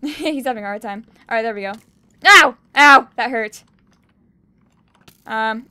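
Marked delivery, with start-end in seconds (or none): 2.3-3.1 loud, higher yelps of pain. Real, not acted. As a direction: yelp as the hit lands. Taking damage.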